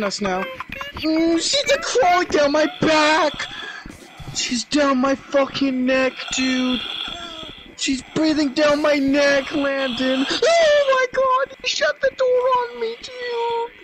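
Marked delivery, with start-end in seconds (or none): 0.9-10.2 frightened, pitched-up, near crying; scared horror game streamer. Real, not acted. Ghost crawling down his back. 10.3-13.7 shrieking in panic, betrayed; panicked shriek. Real, not acted. Teammate shut door on him.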